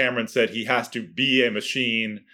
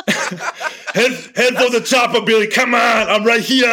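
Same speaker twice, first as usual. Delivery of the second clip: loud, high Austrian bark, laughing at the start; Schwarzenegger impression; 10 semitones higher, 5 dB louder, slower than usual. Shown as a demonstration. Guest continues his Arnold impression, shouting in Austrian accent. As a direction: yell the Predator line like Arnold. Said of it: "Schwarzenegger from Predator".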